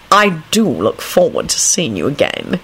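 measured, grave farewell. A narrator's reading. Older man takes leave of the lady, gravely. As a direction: add it with solemn courtesy. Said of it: "he added gravely".

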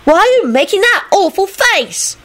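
put-on very high, squeaky child voice; high-pitched little kid question; child's yelp. A narrator's reading. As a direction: ask in a tiny, piping voice. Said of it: "Teddy yelped happily".

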